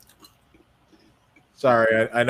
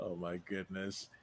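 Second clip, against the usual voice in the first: normal talking voice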